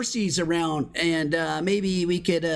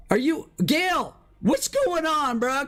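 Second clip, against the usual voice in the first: raised pitch, demanding an answer; exasperated; 7 semitones higher than usual. Real, not acted. Gamer asking Gail if he can hear, exasperated. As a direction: press the other player, voice climbing high. Exasperated at player not hearing.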